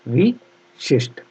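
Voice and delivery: very slow विशिष्ट, vi... shisht; syllable-by-syllable dictation. Shown as a demonstration. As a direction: dictate विशिष्ट in two pieces. Teacher dictating spelling slowly.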